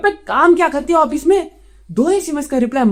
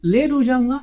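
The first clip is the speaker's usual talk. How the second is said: ordinary delivery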